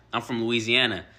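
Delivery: usual speaking voice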